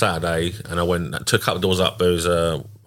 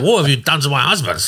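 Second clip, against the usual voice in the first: mock outraged wife; 7 semitones higher than usual. Wife voiced in retelling.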